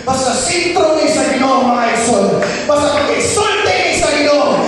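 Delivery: loud, high preaching peak; shouting preacher. Real, not acted. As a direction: drive it home at full force. (nagsinggit nga pagsangyaw)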